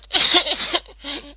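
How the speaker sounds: loud, high exasperated laugh. Acted. Rainbow laughing at her own despair, loud laugh. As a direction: laugh it off in frustration.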